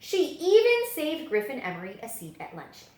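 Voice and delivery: ordinary delivery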